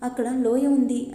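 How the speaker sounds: plain reading voice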